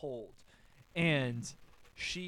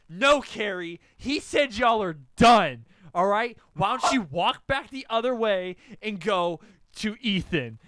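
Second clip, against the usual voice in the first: loud, higher, emphatic scolding; forceful telling-off; 4 semitones higher, 10 dB louder than usual. Retold or imitated. Friend standing between boy and Carrie, scolding loudly. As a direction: step in and order them off, loudly. Friend steps in, acted quarrel.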